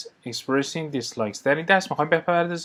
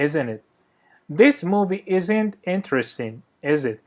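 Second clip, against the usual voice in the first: slow model lines with each "isn't it" and "is it" falling at the end; falling tag tune; 3 dB louder, much slower than usual. Shown as a demonstration. Teacher modelling tag questions for students, the tags falling. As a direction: drop the pitch on the tag "isn't it". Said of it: "به تلفظ این چهار تا جمله دقت کنید".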